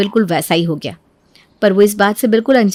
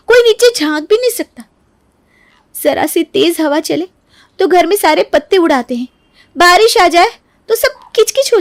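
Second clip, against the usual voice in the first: raised, whining argument; high aggrieved plea; 6 semitones higher than usual. Acted. Neeru argues her side to mother-in-law, high and aggrieved. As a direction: make your case to mother-in-law.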